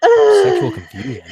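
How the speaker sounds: high, loud disbelieving shout; slow shocked echo; incredulous. Real, not acted. Incredulous echo of friend's line.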